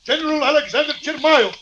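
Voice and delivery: a commanding call through a closed door; a general who insists on being let in; demanding from outside. Acted. Father demanding entry at door.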